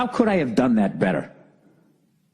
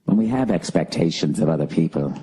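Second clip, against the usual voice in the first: lower-pitched, unhurried calm guide voice; soothing British guide; 8 semitones lower than usual. Retold or imitated. Imitating the Headspace guide for the audience: lower, soothing British calm. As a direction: lull the listener like a meditation app. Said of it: "soothing voice like this, some sort of British accent".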